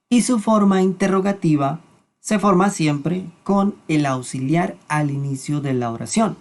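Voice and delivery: ordinary delivery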